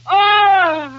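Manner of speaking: very high, wailing cry; demonstrated lady scream. Shown as a demonstration. Director demonstrating a scream to actress, high ladylike shriek. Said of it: "You must scream like a lady".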